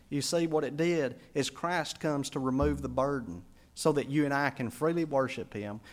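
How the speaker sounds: normal talking voice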